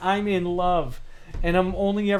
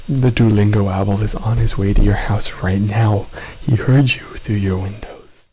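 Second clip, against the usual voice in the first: much lower, louder mock threat; menacing owl voice; 9 semitones lower, 7 dB louder than usual. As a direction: play the Duolingo owl coming for you. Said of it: "threatening A S M R".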